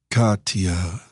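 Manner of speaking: "Katya" with the final a slowly drawn out on the breath; rolled final vowel. A narrator's reading. Stranger savours her name, rolling its final vowel. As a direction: let the last vowel of "Katya" linger, breathy and unhurried. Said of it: "slowly rolling the final vowel with his breath".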